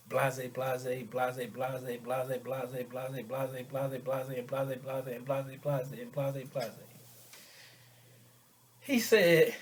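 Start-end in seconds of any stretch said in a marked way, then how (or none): 0.1-6.7 droning filler. Retold or imitated. Skipping over what she went on saying, droning filler. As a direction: rattle it off softly on one note. Skips over her rant.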